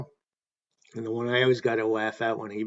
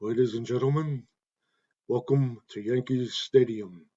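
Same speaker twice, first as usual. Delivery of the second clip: a hint of a grand stadium announcer, slowing on the welcome; Bob Shepard's welcome. Retelling the announcer's mistake at Giants Stadium, a mild stately welcome. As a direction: lay out the greeting like a PA voice in a big bowl. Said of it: "he commenced with the startling announcement".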